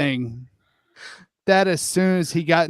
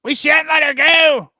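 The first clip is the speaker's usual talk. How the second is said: put-on higher, louder boy's threat; threatening shout; 10 semitones higher, 6 dB louder than usual. Lost Boy to Wendy, a loud threatening shout. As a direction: snap it at her like a cornered boy.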